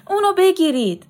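high, loud cry of command; woman's shout. A narrator's reading. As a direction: shout out to have someone seized. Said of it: "زنی فریاد زد". (فریاد بلند و زیر زنانه)

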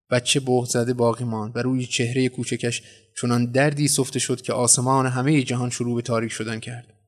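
ordinary delivery, nothing marked

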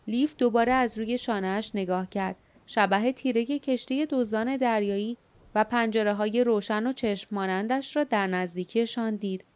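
ordinary delivery

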